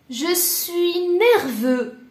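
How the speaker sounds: slow, loud and high, demonstrating anger; acted irritation. Shown as a demonstration. French teacher modelling an anger phrase, emphatically annoyed. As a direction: stretch each word with mock annoyance.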